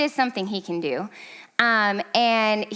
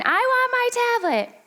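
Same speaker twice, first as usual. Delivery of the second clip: very high-pitched mock kid tantrum; put-on tantrum voice, held high; imitated yelling child; 13 semitones higher than usual. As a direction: mimic a child wailing a demand. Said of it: "And he's yelling".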